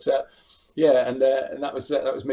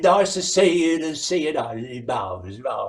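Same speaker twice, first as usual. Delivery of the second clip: Bruce Forsyth. Retold or imitated. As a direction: greet the studio audience like a game show host. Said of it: "do my Bruce Forsyth".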